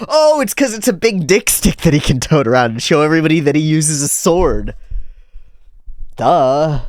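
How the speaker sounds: mock obvious answer. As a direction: answer yourself with heavy sarcasm. Sarcastic answer to own question.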